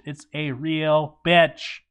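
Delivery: ordinary delivery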